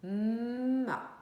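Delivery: "Ma" with a long hummed m; syllable sounded out. Shown as a demonstration. Teacher showing how the syllable is read, drawn-out m. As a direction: hum the m, then release a short a in "Ma". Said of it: "je prends ma".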